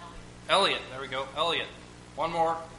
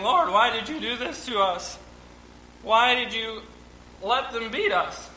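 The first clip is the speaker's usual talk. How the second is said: mock lament; 6 semitones higher than usual. Israel voiced bemoaning loss.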